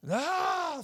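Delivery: very high loud yell. Retold or imitated. Brother yelling in Walmart, very high yell. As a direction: yell at the top of lungs. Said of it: "yelling at the top of his lungs".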